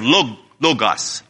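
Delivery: logos with a long O, then an O as in "hot"; long first O. Shown as a demonstration. Preacher teaching the Greek word, logos pronounced LOH-gahs. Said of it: "a long O sound like in the English language".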